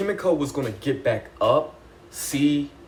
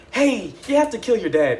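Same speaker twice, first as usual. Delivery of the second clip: put-on higher, louder blunt order to a kid; lightly voiced pushy woman; mock pushy order; 8 semitones higher, 5 dB louder than usual. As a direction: bark the order like it's obvious. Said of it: "Margaret is like".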